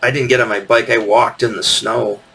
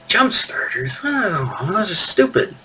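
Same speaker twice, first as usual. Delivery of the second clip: sulky mutter; 9 semitones higher, 3 dB quieter than usual. Retold or imitated.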